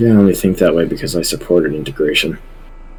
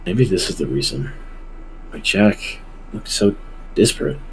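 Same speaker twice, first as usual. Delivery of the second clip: ordinary talk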